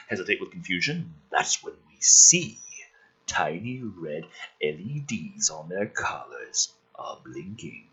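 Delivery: normal talking voice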